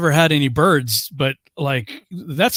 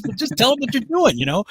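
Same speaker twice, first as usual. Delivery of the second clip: lightly voiced ex-wife, higher; a hint of a patient wife's suggestion; 8 semitones higher than usual. Ex-wife tells her husband to answer the parrot, lightly voiced. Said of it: "And she says".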